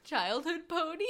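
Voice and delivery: usual speaking voice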